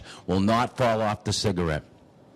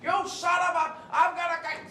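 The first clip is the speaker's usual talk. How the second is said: put-on cranky old man. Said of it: "He's like".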